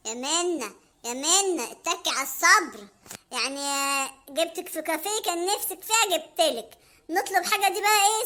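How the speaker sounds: put-on low, grumbling broke boyfriend; a skint guy stalling his date. Hamada, broke, stalling Mona in a low grumble.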